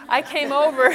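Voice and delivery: said through a laugh; a giggle runs through the words. Real, not acted. Laughs while retelling.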